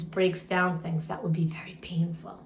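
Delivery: soft whispered aside. Real, not acted. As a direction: whisper it like a secret. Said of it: "aside to listeners".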